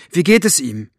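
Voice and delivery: plain reading voice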